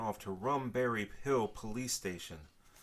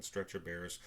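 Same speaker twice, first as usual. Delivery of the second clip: plain reading voice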